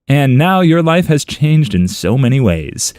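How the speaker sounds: ordinary delivery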